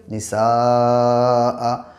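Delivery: निसा with the a stretched very long; drawn-out madd on निसा. Shown as a demonstration. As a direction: hold the a of निसा out for several counts. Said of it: "मद्दे मुत्तसिल होगा".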